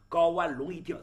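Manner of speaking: ordinary delivery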